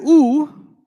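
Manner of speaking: a loud, high "u," stressed hard; u sound. Shown as a demonstration. Teacher stressing the "u," ending for students. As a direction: punch out the vowel "u," for the class. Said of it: "if you hear an".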